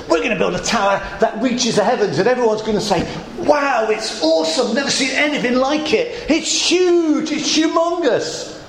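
fast, rising to high hammed-up boasting; mock boastful builders. Preacher mocking Babel builders to congregation, high boastful voice. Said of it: "you have to smile at the irony".

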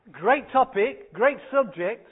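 laughing. Real, not acted. Laughing at the widows punchline.

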